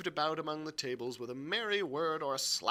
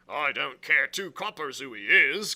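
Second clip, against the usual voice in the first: loud, gruff dismissal; crying out; 9 dB louder than usual. A narrator's reading. Silver on the fleeing customer, crying out. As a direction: throw it out loudly. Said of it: "cried Silver".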